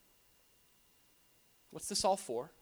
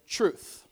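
"truth" set apart and hit louder; stress on truth; 6 dB louder than usual. Shown as a demonstration. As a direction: land firmly on the single word "truth". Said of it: "I wanna emphasize the word there".

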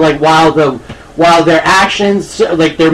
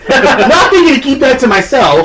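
usual speaking voice

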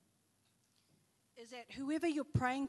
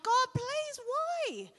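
high, loud desperate plea to God; pleading prayer; 12 semitones higher, 7 dB louder, much slower than usual. Retold or imitated. Kathy crying out to God as her friend gets worse, pleading. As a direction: cry out why to heaven.

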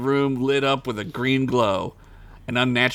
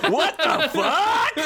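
shocked; much slower than usual. Acted. Rat pulls gun, shocked.

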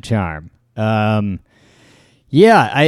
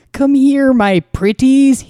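mad scientist; 13 semitones higher, slower than usual. Pretend. Villain voice in cold open.

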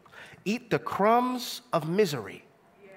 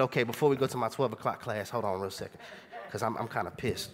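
quiet aside to friends; 8 semitones lower, 6 dB quieter than usual. Retold or imitated. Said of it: "and I said".

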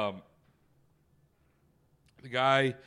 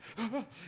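mock gasp; 10 semitones higher, 10 dB quieter than usual. Acting out Blake's fright.

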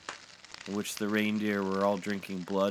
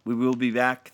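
high, loud group call; raised, urgent shout; yelled over wings; 6 semitones higher, 9 dB louder than usual. A narrator's reading. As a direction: yell back while flying away. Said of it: "they yelled over their wings".

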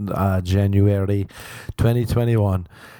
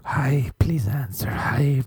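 whispered, a touch higher; mock whisper; 6 semitones higher, slower than usual. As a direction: breathe the plea in a fading whisper. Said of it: "whispered repetition".

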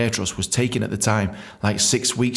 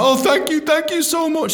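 high-pitched mock gushing thanks; gushing gratitude; 3 dB louder than usual. Reinstated dentist thanks his boss, high gushing gratitude. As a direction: squeal thanks, hammed-up and grovelling.

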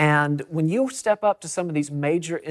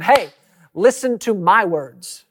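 higher, louder, slow fatherly command; father to son; 4 semitones higher, 6 dB louder, slower than usual. Retold or imitated. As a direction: imitate a father demanding his son's attention. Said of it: "a father to a son going".

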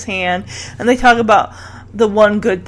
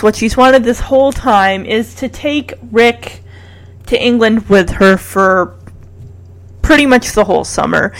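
ordinary talk, nothing marked

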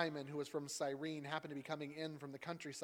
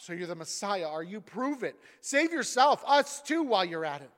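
higher, much louder sneer; scoffing; 5 semitones higher, 12 dB louder than usual. Acted. Criminal beside Jesus taunting him, loud scoffing. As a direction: spit the dare at him.

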